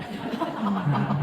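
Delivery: a high burst of laughter. Real, not acted. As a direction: break into laughter at the story. Laughing at his racetrack story.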